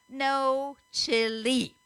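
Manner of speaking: loud, high, hugely drawn-out words; exaggerated impatience. A narrator's reading. Boy repeats his order to the apron man, with exaggerated impatience. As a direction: hammer out each syllable slowly, fed up. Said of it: "With exaggerated impatience, he repeated".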